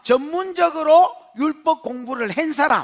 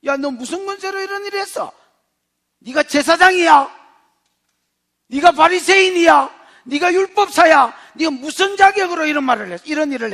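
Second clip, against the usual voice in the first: loud, higher, belittling questions; contemptuous demand; 4 semitones higher, 6 dB louder than usual. Acted. Council belittling the apostles, loud and contemptuous. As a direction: talk down to them hard.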